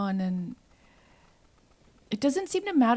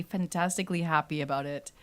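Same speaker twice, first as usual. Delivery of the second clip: ordinary delivery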